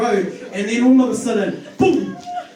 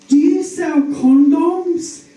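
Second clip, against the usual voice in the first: high, slow, louder caller; caller on the phone; 8 semitones higher, 6 dB louder, much slower than usual. Retold or imitated. Phone caller to the attendant, high slow put-on voice. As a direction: ask it slowly in a high put-on voice. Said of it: "they were like".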